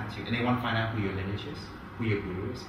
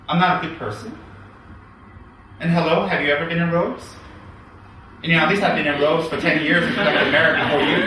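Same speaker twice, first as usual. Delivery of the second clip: loud, higher indignant outburst; indignant rant; 5 semitones higher, 7 dB louder than usual. Real, not acted. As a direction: argue back hotly, half laughing at the absurdity. Indignant rant against sectarian gossip.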